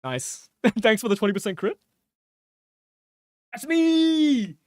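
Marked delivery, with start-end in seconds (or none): 3.5-4.6 much higher, then slow mock bragging; hammed-up character voice. Streamer bragging as the character that just landed a crit, hammed-up. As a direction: boast in a silly put-on voice.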